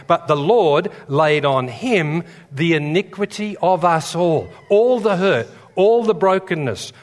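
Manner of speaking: loud, rising pulpit cadence, hammering each "all"; fervent preaching. Acted. Preacher drives home Easter message, loud fervent preaching. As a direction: preach it to the back row.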